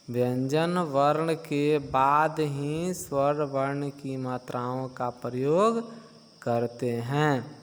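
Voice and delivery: slow, deliberate restatement; slow dictation. Shown as a demonstration. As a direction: dictate it slowly for the class. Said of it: "repeats rule slowly".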